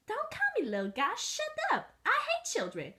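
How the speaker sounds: high, squeaky, grumpy little dog. Acted. Little dog scolding the girl, high and cranky.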